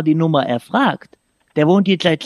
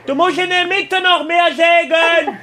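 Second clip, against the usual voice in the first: mock shout; 10 semitones higher than usual. Prank caller shouts instructions to brother.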